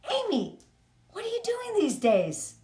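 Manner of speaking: high, bright acted friendly question; acquaintance asking. A friend asks Amy what she does, high-pitched acted greeting. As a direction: play a curious acquaintance, pitched well up. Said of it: "Practice when someone says to you".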